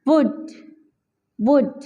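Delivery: वुड with a silent L; वुड said short, no L; correct pronunciation demo. Shown as a demonstration. Teacher models would for students. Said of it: "और यहाँ पे वुड".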